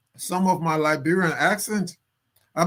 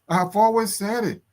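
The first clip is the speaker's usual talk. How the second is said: ordinary talk, nothing marked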